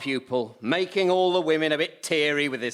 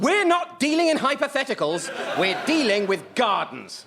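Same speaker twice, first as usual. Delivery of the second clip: indignant snap; 8 semitones higher, 3 dB louder than usual. Acted. Host snaps at caller.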